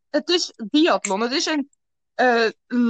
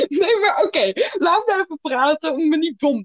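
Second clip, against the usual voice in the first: laughing; 2 semitones higher, 3 dB louder than usual. Real, not acted. Laughs through it.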